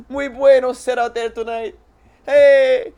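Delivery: hammed-up Spanish accent. Cheesy comic telling a podcast host his jokes, in a hammed-up Spanish accent.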